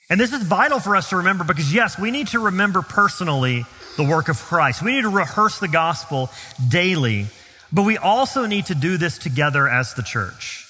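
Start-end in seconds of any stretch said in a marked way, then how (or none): none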